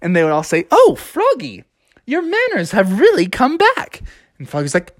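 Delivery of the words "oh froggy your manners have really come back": high, cooing townsfolk voice; admiring passersby. Acted. Townsfolk praising Froggy's manners, high and admiring. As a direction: coo with approval, pitched high.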